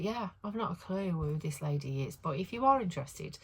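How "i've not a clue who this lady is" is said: usual speaking voice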